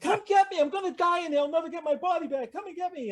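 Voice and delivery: high, loud begging to be rescued; mock panic. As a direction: plead frantically, way up high. Stranded player begging for rescue.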